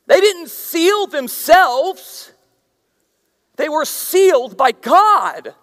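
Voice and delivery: loud, high fiery preaching; preaching at full force. Real, not acted. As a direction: drive each line home to the congregation.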